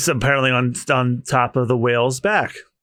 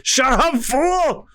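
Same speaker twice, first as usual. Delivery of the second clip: scolding crewmate; 9 semitones higher, much slower than usual. Acted. Zoro scolding Luffy.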